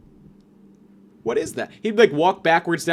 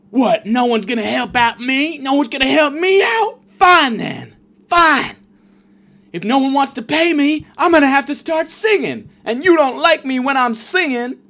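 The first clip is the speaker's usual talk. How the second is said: high, loud, wailing beggar voice; whiny train beggar; 11 semitones higher, 6 dB louder than usual. Retold or imitated. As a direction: whine and threaten like a pushy train performer. Train man whiny threat.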